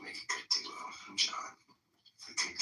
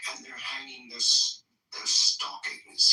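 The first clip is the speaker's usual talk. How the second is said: snarling Grinch; 4 semitones higher, 12 dB louder, slower than usual. Acted. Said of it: "he snarled with a sneer".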